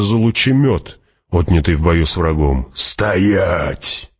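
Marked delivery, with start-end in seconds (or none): 2.7-4.1 drawn-out command, no real shout; barked order. A narrator's reading. Hawat stopping his own men, barked order. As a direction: halt them with a restrained bark. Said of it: "рявкнул Хават".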